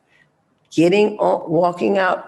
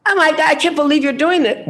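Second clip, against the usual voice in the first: mock outraged woman; 8 semitones higher than usual. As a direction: play her clutching her pearls.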